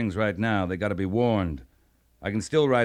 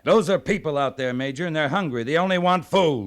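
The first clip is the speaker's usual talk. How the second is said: heated protest; 6 semitones higher than usual. Acted. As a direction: fight for them, voice rising. Arguing heatedly with the major.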